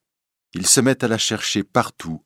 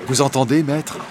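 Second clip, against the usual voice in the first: raised, higher-pitched urgent question; urgent alert; 6 semitones higher, 3 dB louder than usual. Acted. Gringoire alerting his master to noises, urgent. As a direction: alert your master, voice lifted.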